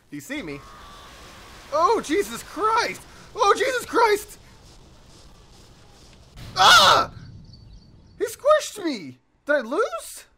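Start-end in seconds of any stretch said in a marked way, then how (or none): 1.7-4.4 very high, loud, drawn-out panic; alarmed shout. Real, not acted. Gamer's spider about to be squished, high alarmed shouting. 6.6-7.1 very loud, high scream; startled scream. Real, not acted. Gamer's spider gets squished, loud high scream. 8.2-10.3 high, loud disbelieving cry; shocked outcry. Real, not acted. Gamer just squished in game, high shocked outcry.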